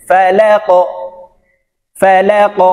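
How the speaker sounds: "ফা লা ক" ending in a rounded echo; upward qalqala. Shown as a demonstration. Teacher repeating "ফা লা ক" for learners, upward qalqala. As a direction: bounce the ক of "ফা লা ক" upward. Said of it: "উপরের দিকে ধাবিত করে".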